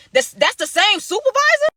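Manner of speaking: can't believe it's the same guy; shocked disbelief. Real, not acted. Narrator realizing the caller is his own supervisor, stunned and high. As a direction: pitch jumps high in disbelief.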